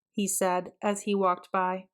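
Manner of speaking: usual speaking voice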